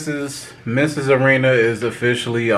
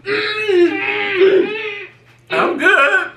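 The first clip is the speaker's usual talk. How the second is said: high, strained, fighting nausea; choking down the taste; holding back gagging. Real, not acted. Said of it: "Keep it together".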